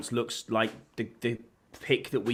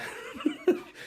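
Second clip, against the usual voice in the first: high-pitched laugh; 4 dB quieter than usual. Real, not acted. Laughs at a bread roll joke.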